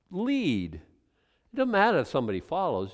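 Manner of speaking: ordinary talk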